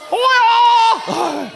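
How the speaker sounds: high, loud martial-arts yell; hammed-up karate shout; mock ninja cry. Kid in headband, ninja joke.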